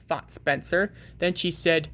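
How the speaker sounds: ordinary delivery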